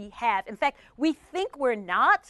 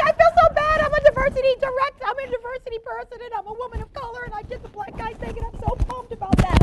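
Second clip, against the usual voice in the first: guilty colleague; 10 semitones higher, 4 dB louder, faster than usual. Retold or imitated. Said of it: "she's like".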